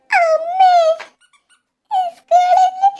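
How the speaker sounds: high tearful wail for mother; crying call. Acted. Sick little girl calling her mother, high crying wail. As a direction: cry out for mummy like a small child. Frightened sick child cries.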